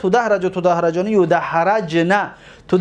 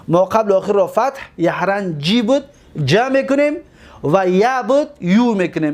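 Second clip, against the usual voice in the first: ordinary talk, nothing marked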